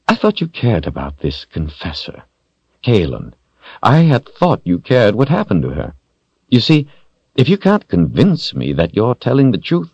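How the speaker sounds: a touch of silky menace; threatening villain. A narrator's reading. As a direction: threaten lightly, almost conversationally. Villain threatens captive's loved one.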